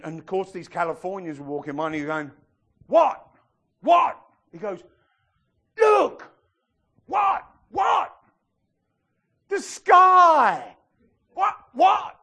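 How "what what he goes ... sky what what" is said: shouted high, loud, bewildered; astonished shouts. Acted. Movie character shouting at sky, astonished high yells. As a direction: yell it like a man seeing sky first time. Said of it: "He went".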